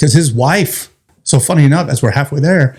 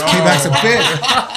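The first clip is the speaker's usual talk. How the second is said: slow, higher, laughing through it; laughing gloat; 6 semitones higher, slower than usual. Retold or imitated. Retelling partner's gloat, laughing at the memory. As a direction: let the laugh spill over the words. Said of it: "It was".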